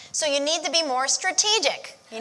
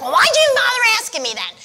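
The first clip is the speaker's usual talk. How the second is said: higher, louder fed-up outburst; exasperated father; 10 semitones higher, 6 dB louder than usual. Retold or imitated. Father fed up when his wife ignores his advice, exasperated. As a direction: throw up your hands at her. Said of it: "He'd be like".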